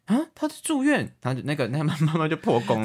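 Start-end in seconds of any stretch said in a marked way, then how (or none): 0.1-1.1 flustered mother. Retold or imitated. Mother blurts out daughter's lie.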